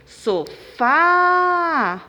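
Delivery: Sofá with FÁ held long, high and loud; so-FAAA, sustained on the ending; stressed syllable exaggerated. Shown as a demonstration. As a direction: call out Sofá, stretching the last syllable. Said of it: "é o fá".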